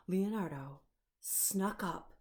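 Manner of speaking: ordinary delivery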